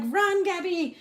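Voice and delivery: high urgent call to flee; mock panicked order. Retold order to flee fire.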